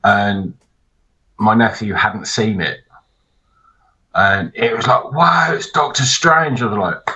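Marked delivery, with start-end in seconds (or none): 5.1-6.6 higher, slow wide-eyed wonder; awed kid. Retold or imitated. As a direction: gasp at the trailer like a thrilled child. Nephew awed at trailer.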